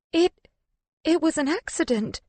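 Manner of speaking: high, faltering protest; stuttered. A narrator's reading. Woman backing away from her accuser, stuttering. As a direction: break off after the first word, then restart. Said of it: "I stutter".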